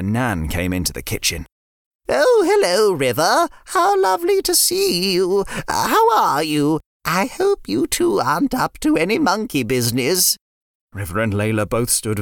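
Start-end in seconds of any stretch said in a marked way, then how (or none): 2.1-10.4 higher, put-on grandma voice; a fond nan greeting the kids; cooing granny voice, pitched up; sweet old nan.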